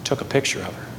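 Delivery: low, subdued confession; ashamed admission. Retold or imitated. Pastor confessing to a church member, ashamed and quiet. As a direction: admit it quietly, head bowed in shame. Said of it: "had to bow his head in shame".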